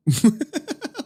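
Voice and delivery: a sudden squeaky burst of laughter; high squeal laugh. Real, not acted. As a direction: shriek with laughter. Laughing at his deep voice.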